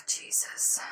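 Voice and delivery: breathy whispered exclamation. Real, not acted. Vlogger recalling the scare, whispered exclamation. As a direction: exhale it under your breath. Exhausted aside after the story.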